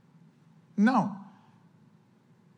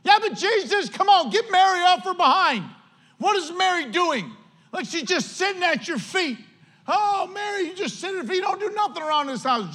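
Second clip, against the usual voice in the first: high whiny complaining; whiny Martha; 9 semitones higher than usual. Retold or imitated. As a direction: whine to Jesus about her sister.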